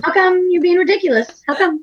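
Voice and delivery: high, playful scolding; mock indignation. Co-host scolding the other states, mock indignant.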